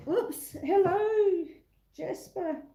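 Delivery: usual speaking voice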